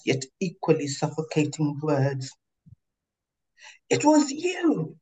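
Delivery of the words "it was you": gasped. Acted. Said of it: "she gasped".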